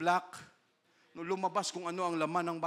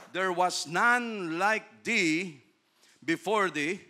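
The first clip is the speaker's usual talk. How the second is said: very slow scripture; 4 dB louder, much slower than usual. Acted. Reading verse slowly.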